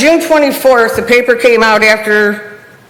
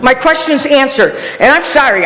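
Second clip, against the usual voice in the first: ordinary talk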